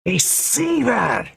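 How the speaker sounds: high, drawn-out ghostly scream. Acted. Ghost screams at the deceiver. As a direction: scream it in rage, slowly. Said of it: "she screamed".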